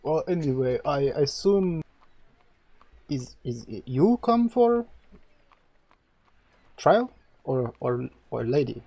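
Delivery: lightly voiced priest in broken trade language; halting foreign priest. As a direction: speak as a curt, halting priest.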